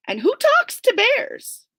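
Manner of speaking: high, loud disbelief; incredulous. A narrator's reading. Narrator baffled someone talks to bears, incredulous. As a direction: ask it in total disbelief.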